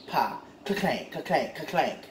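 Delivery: mock ka-clank sounds under the breath; imitated clank. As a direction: mimic the metal clanking. Imitating clanking noise from potholes.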